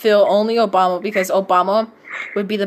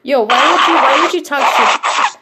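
louder and higher, indignant yo why would you; indignant outburst; 6 semitones higher, 4 dB louder than usual. Real, not acted. Reacting to the fanfic, indignant and loud. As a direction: call out the rude line, loudly. Outraged reaction to story line.

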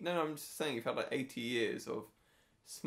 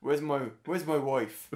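loud, slow mock Irish; hammed-up Irish film hero; Liam Neeson; 3 semitones higher, 8 dB louder, slower than usual. Co-host keeps up the film impression, loud Irish accent. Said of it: "you confusing Harrison Ford and Liam Neeson".

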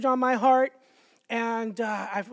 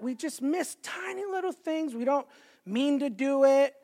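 usual speaking voice

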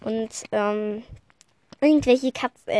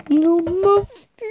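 alberne Ansage; 7 semitones higher, 7 dB louder, slower than usual. Shown as a demonstration. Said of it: "gesungene Ansage".

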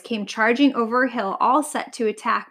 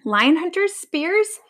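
high, alarmed questions; frightened wail; 7 semitones higher, slower than usual. A narrator's reading. Lion just told of hunters, frightened wail. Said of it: "wailed Eli".

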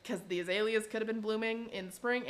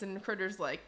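usual speaking voice

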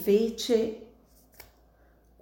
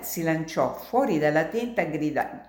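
usual speaking voice

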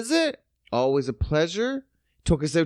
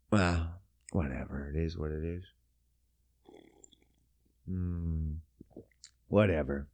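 much lower, much softer "whatever"; resigned mumble; 10 dB quieter than usual. Real, not acted. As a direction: let it go with a defeated sigh. Resigned trailing off.

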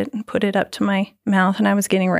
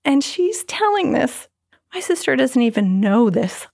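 normal talking voice

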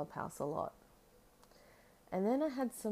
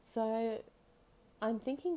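usual speaking voice

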